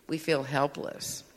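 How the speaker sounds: normal talking voice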